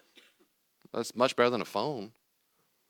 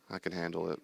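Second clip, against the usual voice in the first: soft, low-key answer; calm self-talk; 3 semitones lower, 7 dB quieter than usual. Acted. Calm self answering the fretting churchgoer, soft and unbothered. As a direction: keep it quiet and unbothered.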